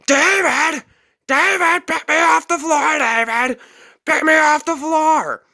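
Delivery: put-on high, loud desperate old man's shriek; mock helpless old man screaming high. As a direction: wail for David from the floor. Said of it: "He was screaming".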